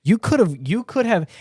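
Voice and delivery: high, emphatic lament; exasperated plea to a villain; impassioned reproach. Pretend. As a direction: reproach them, punching it up high. Reproaching Am directly.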